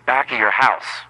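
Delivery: a hint of a nagging mother; lightly voiced scolding mom; Todd's mom. A mother scolding Todd on the phone, lightly nagging. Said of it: "it's your mother".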